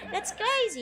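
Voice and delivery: high, loud disbelief; incredulous. Real, not acted. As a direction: act baffled by the comment. Reacting to chat.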